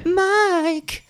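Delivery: squeaky high "Mike"; playful high squeal. Pretend. Friend squeals the name Mike as a joke, high.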